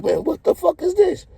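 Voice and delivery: high-pitched imitation of an irritated dealer; mock angry dealer. Dealer complaining about the pile of small bills, high mock anger. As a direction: squeak out the dealer's annoyance. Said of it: "he was mad as hell".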